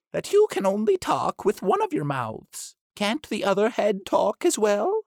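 high duck voice scolding the doctor; bossy duck. Acted. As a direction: insist like a fussy duck. Duck questions Pushmapulya.

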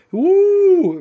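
loud high woo of joy; joyful whoop. Acted. Simpleton whoops with joy at the news. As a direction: let out a happy whoop.

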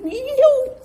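high, cracking cry of e eu; pleading through tears, voice climbing high; choked-up retold plea to God; tearful plea.

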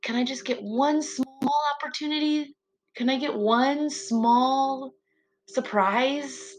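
small, high, pleading voice asking God; a lightly voiced hopeful plea; pleading prayer voice. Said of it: "I'm like".

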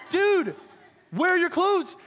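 high, loud disbelieving yell; exasperated shout. Retold or imitated. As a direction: act out a leader at wits' end. Leader confronts naked kid again.

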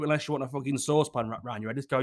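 ordinary delivery

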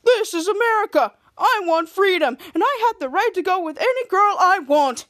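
high, loud, whiny mock of his voice; mock his big freedom speech; hammed-up imitation of a boy; imitating Kid Danger. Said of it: "she imitated him".

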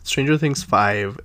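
ordinary talk, nothing marked

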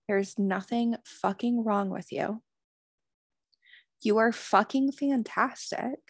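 normal talking voice

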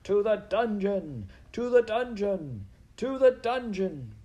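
slow, high, loud mob chant; outraged bellow. A narrator's reading. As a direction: chant it like an angry crowd. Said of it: "a bellow of collective outrage".

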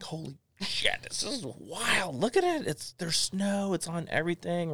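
high, amazed squeal over the snow; freaking out. Retold or imitated. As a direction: gush like you've never seen snow. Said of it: "I was freaking out".

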